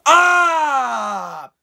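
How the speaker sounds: loud, high "Ah!" of alarm; mock startled cry; startled yell. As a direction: blurt out a high yelp like the hero spotting them. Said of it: "he goes".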